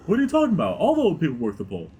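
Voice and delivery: high-pitched, hammy dad on the phone; put-on dad voice. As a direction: greet in a silly high dad voice. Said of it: "I better call my daughter".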